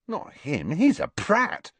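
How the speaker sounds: put-on high girlish schoolgirl voice; a high, scornful girl; Pansy voice.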